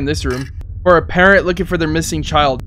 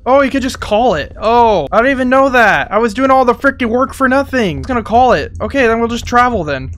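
higher, amazed realization; surprised; 6 semitones higher than usual. Real, not acted. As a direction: exclaim in disbelief. Discovers the drone can be called.

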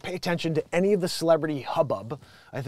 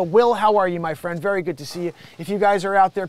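ordinary delivery

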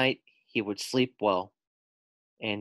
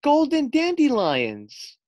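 delighted gasp; 6 dB louder, slower than usual. Acted. Characters find gold at rainbow's end.

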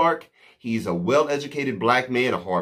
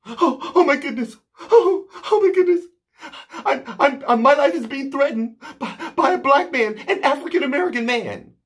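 high, mocking panic voice, much higher and a little louder; 13 semitones higher, 3 dB louder, faster than usual. Mimicking the woman's phony panicked call to police, hammed-up hysteria. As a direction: parody her fake hysteria on the phone. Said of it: "ginning up all of this fake hysteria".

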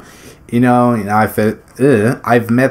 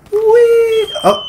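high, playful squeal of glee; gleeful whee. Real, not acted. Gamer squeals in glee as he launches an enemy, high playful whee. Gleeful yell during a kill.

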